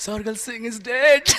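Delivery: a man mourning in mock grief to his king; imitating a grieving subject, voice breaking into laughter; mock sad lament. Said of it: "He said so sadly".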